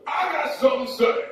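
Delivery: put-on high-pitched drunken outburst; drunk interrupter. Drunk man interrupting the introductions, high and slurred. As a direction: butt in as a tipsy heckler. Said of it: "That's what he sounds like".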